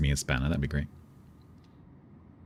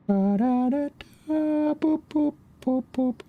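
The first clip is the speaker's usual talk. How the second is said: high sung beeps, slow; sung docking jingle; much slower than usual. Pretend. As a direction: hum a spaceship docking tune. Humming the docking music.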